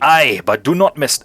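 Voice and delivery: knight slipping into Scottish; Scottish accent. Acted. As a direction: begin a proud declaration in accidental Scottish. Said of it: "why am I going Scottish".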